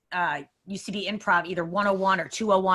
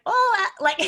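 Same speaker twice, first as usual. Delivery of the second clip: Southern belle; 11 semitones higher, 4 dB louder than usual. Retold or imitated. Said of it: "I said it like the biggest Southern belle".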